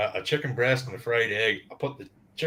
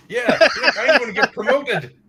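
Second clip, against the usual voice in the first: laughing; 10 semitones higher, 8 dB louder than usual. Retold or imitated. Mocking quote from film.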